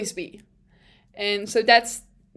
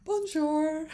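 badly faked "Bonjour" while cry-laughing; imitating Otmar's bonjour through tears of laughter; 5 semitones higher, 5 dB quieter than usual. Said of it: "The man didn't even try".

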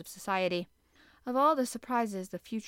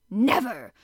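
loud defiant cry of refusal; 3 semitones higher, 11 dB louder than usual. A narrator's reading. Harpooner shouting his refusal at the captain, loud defiant cry. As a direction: hurl the word out as a shout. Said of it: "cried Ned Land".

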